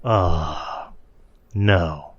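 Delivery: soft, drawn-out mock dismay; hushed crowd reaction. Retelling the whole place going silent, hushed dismay. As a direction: let it sink into a hush. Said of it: "has this hush of like".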